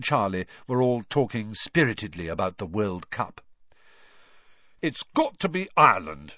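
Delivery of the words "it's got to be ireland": mouth full. Acted. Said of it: "said Charlie thickly through a mouthful".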